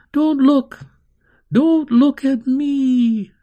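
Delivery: high, loud, drawn-out scream. A narrator's reading. Child screaming when no one is in the room, high frantic scream. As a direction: scream it out, stretching the last words. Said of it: "Carney would scream".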